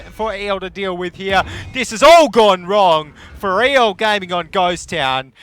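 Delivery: full-voiced, high-pitched play-by-play shout; excited shouting. Real, not acted. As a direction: call the decisive collapse at the top of your voice. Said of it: "we're shouting".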